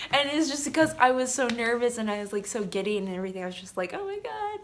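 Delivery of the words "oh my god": high-pitched mock-mortified squeak. Recalling giddy nerves around her crush, mortified squeak. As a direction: squeak it high, embarrassed at yourself.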